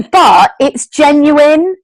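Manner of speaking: high, slow and choked up for real; getting emotional. Real, not acted. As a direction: push on through welling emotion. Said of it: "I'm getting emotional".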